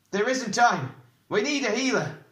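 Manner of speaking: high, loud, pressing protest; urgent protest. A narrator's reading. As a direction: push back hard, desperate for help. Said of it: "protested Eragon".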